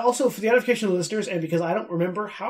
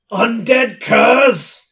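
slow, loud demonic call; 5 semitones higher, 9 dB louder, much slower than usual. Acted. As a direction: growl it out like a demon. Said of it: "in a demonic voice that I can't make reach the mic".

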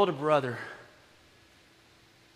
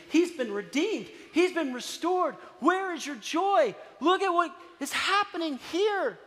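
rising joyful plea; 10 semitones higher, slower than usual. Retold or imitated. Father pleading, rising to shout.

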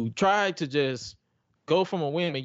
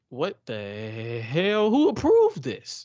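incredulous; 3 semitones lower, much slower than usual. Retold or imitated. Mock outrage at bad idea.

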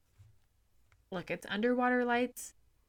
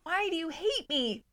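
mock whiny plea; 9 semitones higher than usual. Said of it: "be like".

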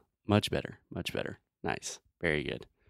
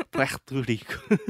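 higher, hammed-up fake Spanish accent; Trump's mock Spanish; 5 semitones higher, 4 dB louder than usual. Teacher repeats Trump parody, exaggerated fake Spanish accent. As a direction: overdo a fake Spanish Puerto Rico, PWEHHR-to. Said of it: "Trump, for some reason, thinks you say".

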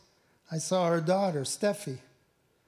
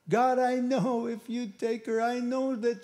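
higher, slow, pleading prayer; crying out to God; 4 semitones higher, slower than usual. Retold or imitated. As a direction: pray as if the words are breaking. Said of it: "I'm crying out to God".

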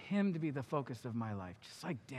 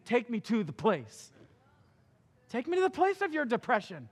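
louder, higher pleading call; plain loud; 6 semitones higher, 6 dB louder than usual. Acted. God calling the hurting, loud pleading. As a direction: call it out to them. Preaching at full force.

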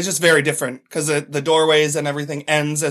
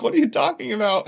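higher, lightly voiced puzzled Brit; mock British co-star; 8 semitones higher than usual. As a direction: play the actor baffled by the question. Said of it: "He's like".

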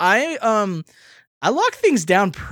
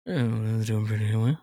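sensual low voice; 9 semitones lower, 6 dB quieter, faster than usual. Acted. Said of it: "That was kinda sensual".

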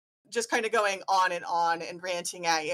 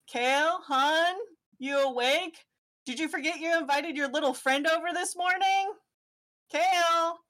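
higher, sing-song dad call; dad calling through door; 8 semitones higher than usual. Acted. Dad knocking on Kale's door, lightly voiced. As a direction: coax a sleepy kid awake.

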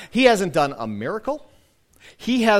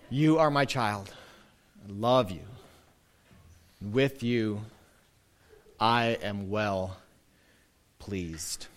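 tender blessing; 7 semitones lower, 6 dB quieter, slower than usual. Acted. Blessing spoken over congregation.

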